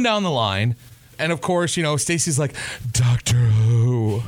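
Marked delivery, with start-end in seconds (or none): 2.9-4.2 drawn-out, low sultry purr; mock-sultry, low and slow, imitating a friend; seductive. Teasing a friend by mimicking her answer, seductive. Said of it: "she said it in the most seductive way".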